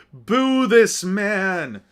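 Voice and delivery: slow, loud mock jeer; hammed-up slow boo chant; drawn-out boo call. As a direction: stretch out the heckle. Booing friend.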